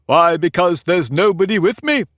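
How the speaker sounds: cried out. A narrator's reading. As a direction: call it out, pleased with himself. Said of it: "cried Humpty Dumpty".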